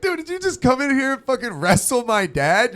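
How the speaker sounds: high-pitched imitation of an outraged daughter; mock outraged daughter. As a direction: squeak it out like a shocked daughter. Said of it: "She's like".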